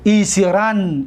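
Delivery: ایسرن drawn out slowly with a long final n; slow demo. Shown as a demonstration. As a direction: say ایسرن slowly for students to copy.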